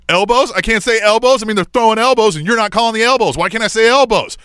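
re-enacted heated complaint; indignant protest. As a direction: argue back at the referee in disbelief. Acted argument with the referee.

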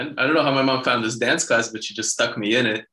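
ordinary delivery

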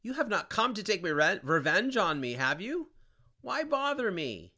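high, loud wailing cry; kicked sinner wailing at the one who kicked him; weeping scream. A narrator's reading. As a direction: scream it through tears. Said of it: "Weeping, he screamed".

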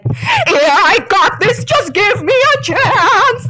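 strained, slow, high gasping voice of an exhausted driver; imitating a wrecked F1 driver on team radio, slow and strained; dying driver. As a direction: croak it out like you're barely alive. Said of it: "sounding like he was dying".

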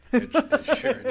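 high squealing fan voice; mock fangirl squeal. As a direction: squeal his name like a fan. Mock squeal at [name]'s name.

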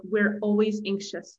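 ordinary delivery

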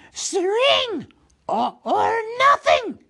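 very high, loud creature shriek; Gollum's shriek. A narrator's reading. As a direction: shriek out a desperate guess. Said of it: "shrieked Gollum".

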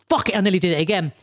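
ordinary delivery

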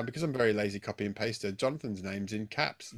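normal talking voice

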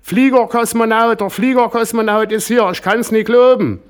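shrieking at the top of her lungs, very high and loud; roaring shout. Acted. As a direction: screech it out, starstruck and beside herself. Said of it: "brüllte".

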